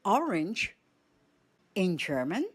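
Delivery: ordinary talk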